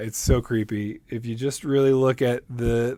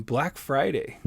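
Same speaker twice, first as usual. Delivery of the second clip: ordinary talk, nothing marked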